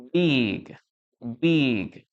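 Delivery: "big" with a voiced hard G at the end; correct pronunciation. Shown as a demonstration. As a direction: model "big" carefully, sounding the final G. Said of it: "La letra G".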